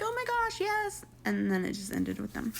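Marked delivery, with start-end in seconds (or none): 0.0-1.1 high, small-voiced mock excitement; imitated delighted acceptance. Woman opening the ring box, mock thrilled. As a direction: squeak a yes to a proposal.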